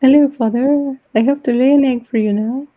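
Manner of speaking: high-pitched, playful talking hen; hen talking to father. Acted. Hen announcing an egg to her owner, playful high voice.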